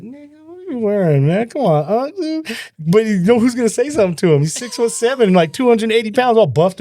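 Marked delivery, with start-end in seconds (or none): 0.0-2.6 high, mock-pleading exasperation. Teasing his uncle about his outfit in high mock exasperation. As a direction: plead with him, half joking. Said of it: "you're like".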